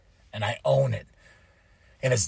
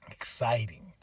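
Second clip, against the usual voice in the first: a hushed whisper, much softer; 8 semitones lower, 15 dB quieter, slower than usual. Real, not acted. Savouring the moment to the viewers, much softer whisper. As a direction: breathe it out in a whisper. Hushed aside after the cheers.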